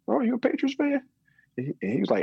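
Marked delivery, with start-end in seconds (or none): 0.1-1.0 high-pitched imitated question; retold curious question. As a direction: voice jumps up high as you mimic her asking. Retold stranger's surprised question at coffee shop.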